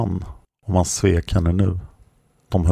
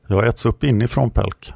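plain reading voice

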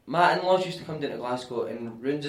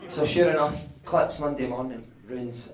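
ordinary talk

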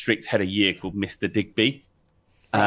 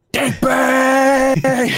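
very high, loud drawn-out yell; shouting out a window; 6 dB louder than usual. Retold or imitated. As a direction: holler the name across the courtyard. Shouting teacher's name out window.

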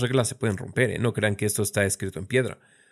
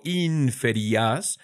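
slow syllable stretch; much slower than usual. Shown as a demonstration. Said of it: "podemos tener dos sílabas largas".

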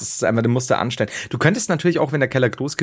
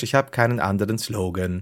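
ordinary talk

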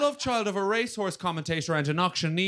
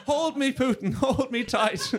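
mock pleading; 9 semitones higher, slower than usual. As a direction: beg for comfort in fake fright. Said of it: "I'm like".

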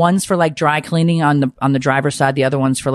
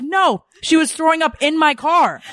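high-pitched indignant retelling; exasperated; 11 semitones higher than usual. Retold or imitated. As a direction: act out outrage on the phone. Retelling exasperated phone call.

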